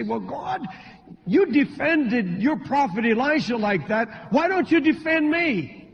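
high-pitched, acted protest rising to the end; aggrieved complaint. Believer asking God why no defence comes, mock aggrieved.

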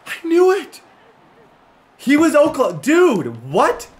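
shouting with gleeful disbelief; excited shout. Real, not acted. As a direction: burst out in triumph. Finds player's college, celebrates.

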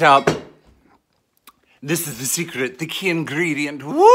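ordinary talk

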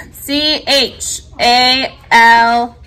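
each letter spaced far apart; slow spelling. Shown as a demonstration. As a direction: spell it very slowly for the class. Teacher spells word for class.

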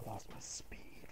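breathless whisper after laughing. Real, not acted. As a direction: wheeze it out, nearly no voice.

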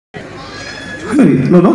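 ordinary delivery

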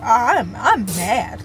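drawn-out, slow I'm mad; slow admission. Real, not acted. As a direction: admit it slowly, holding it back. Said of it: "not to be mad".

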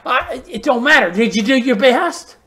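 ordinary delivery

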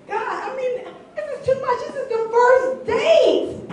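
high-pitched overwhelmed complaint, stretched at the end; exasperated. Acted. Woman appealing to God about first date, exasperated. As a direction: plead upward in exasperation.

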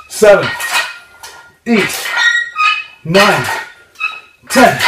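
counting reps while lifting hard; straining counts. Real, not acted. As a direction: push each number out under the strain. Counting reps mid-exercise.

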